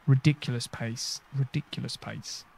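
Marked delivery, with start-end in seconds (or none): 1.4-2.4 said again under the breath; a soft, muttered echo; quiet repeat. Real, not acted. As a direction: mutter it a second time to yourself. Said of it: "repeats the phrase".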